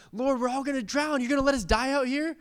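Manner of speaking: high-pitched frantic pleading; mock panic. Disciples crying to the Lord in the storm, mock panicked. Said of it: "they're freaking out and they're running around".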